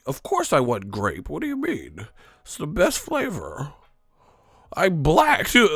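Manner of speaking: mock room service caller, squeaky on the punchline; mock customer voice. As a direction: play a fake caller announcing himself.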